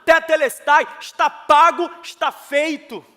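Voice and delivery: loud, high proclamation; impassioned preacher's declaration; full-force preaching. Real, not acted. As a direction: proclaim it to the congregation, phrase by phrase.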